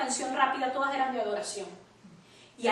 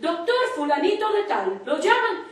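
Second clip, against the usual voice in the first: higher-pitched mock intercom call; imitated paging announcer; 4 semitones higher, 3 dB louder than usual. Speaker mimicking the calls paging a doctor, high announcer voice.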